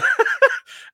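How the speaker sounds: sudden high-pitched laugh; high laugh burst. Real, not acted. Host laughing at his own rant, high giggle. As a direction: let out a squeaky giggle.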